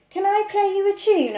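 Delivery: put-on high, eager little boy; little boy's voice. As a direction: ask like a keen small boy. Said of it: "asked Lester".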